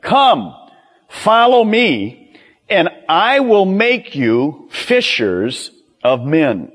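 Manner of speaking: high, slow, deliberate summons; Jesus calling. Acted. Jesus calls the fishermen to follow him, high deliberate call. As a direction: issue a firm call, each phrase set apart. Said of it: "Jesus said".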